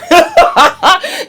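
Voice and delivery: a burst of high, bright giggling; squealing laugh at her own joke; high giggle. Real, not acted.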